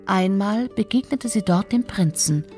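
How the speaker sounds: ordinary delivery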